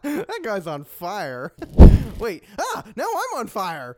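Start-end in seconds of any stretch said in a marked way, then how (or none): 1.6-2.1 sudden loud laugh; loud laugh burst. Real, not acted. 2.2-4.0 high alarmed cry. Acted. Character also catches fire.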